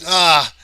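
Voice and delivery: sudden loud ah of alarm; frightened shout in the cave; loud startled cry. Acted. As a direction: yelp as the beasts close in. Cry as monsters approach.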